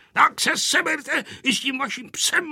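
high-pitched, cross grandpa; grandpa's ranting. A narrator's reading. Grandpa ordering children and dog away, ranting. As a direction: rant at them indignantly. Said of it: "povykoval dědeček".

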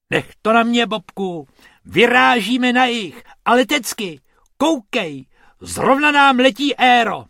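high, loud, eager command; rally a friend for departure; boisterous high-pitched announcement; rousing take-charge. A narrator's reading. Friend takes charge, rallies for departure.

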